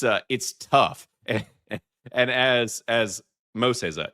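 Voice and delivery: normal talking voice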